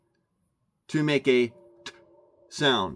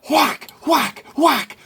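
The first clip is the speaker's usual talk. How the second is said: mock whacking; 12 semitones higher, 8 dB louder, slower than usual. Miming beating a dead horse.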